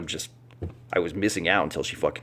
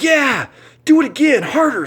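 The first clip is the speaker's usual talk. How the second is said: squeaky, very high and loud plea for more; mock eager begging; 8 dB louder than usual. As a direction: ham up her shrill eager demand. Wife begs for harder slaps.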